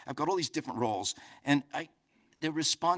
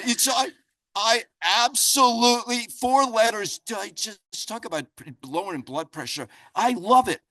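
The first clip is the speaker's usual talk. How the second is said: higher and louder, gushing over the word; mock gushing; 9 semitones higher, 9 dB louder than usual. Real, not acted. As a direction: ham up your love for the swear word. Joking rant about the F word.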